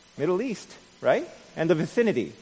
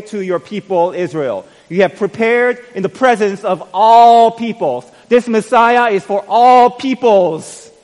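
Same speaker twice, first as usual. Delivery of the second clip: loud, high, slowing sermon proclamation; full-force preaching; 7 semitones higher, 12 dB louder than usual. Acted. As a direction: proclaim it to the whole church, much louder.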